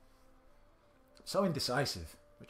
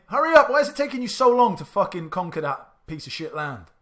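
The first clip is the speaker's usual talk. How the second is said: furious, much louder and higher shout; angry yelling; 10 semitones higher, 17 dB louder than usual. Real, not acted. Yelling at slow army in game.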